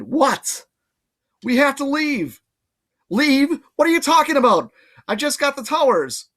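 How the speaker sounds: put-on high, loud irritated girl; annoyed girl voice. Annabeth snapping at Percy, high and irritated. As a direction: bristle at being interrupted.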